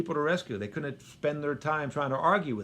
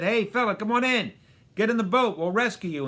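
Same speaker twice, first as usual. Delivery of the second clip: raised, higher hail from a boat; calling out; 6 semitones higher, 5 dB louder than usual. Retold or imitated. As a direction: call him in from the water, higher and louder.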